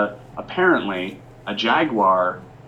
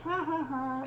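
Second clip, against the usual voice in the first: put-on creepy kid laugh; 9 dB quieter than usual.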